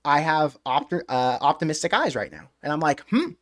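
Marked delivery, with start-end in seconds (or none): none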